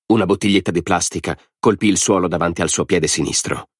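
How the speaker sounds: ordinary delivery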